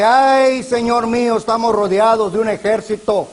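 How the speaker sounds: high, loud alarmed cry; frightened cry. Retold or imitated. The servant on the city wall crying to Eliseo, loud and afraid. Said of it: "pega un grito y dice".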